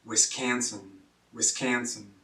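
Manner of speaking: Wisconsin accent, "Wisconsin" as wis-KAN-sin; Wisconsin regional accent. Shown as a demonstration. Teacher showing learners the local Wisconsin accent. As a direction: flatten the O to a short A, Wisconsin accent. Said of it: "there's a regional accent".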